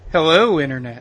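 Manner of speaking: sultry voice. Acted. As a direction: say hello seductively, like a perfume ad. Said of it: "Very sultry voice you got there".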